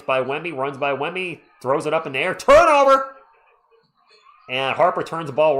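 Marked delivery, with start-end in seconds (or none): 2.3-3.2 loud, high triumphant call; excited shout. Real, not acted. Fan calling a Spurs turnover, loud high excited shout. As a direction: belt out the steal.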